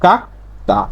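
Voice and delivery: কাকতা split into "kak" and "ta", with a voiceless t; corrected t reading. Shown as a demonstration. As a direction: model কাকতা syllable by syllable, t not d. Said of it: "তখন উচ্চারণটা হবে কি?".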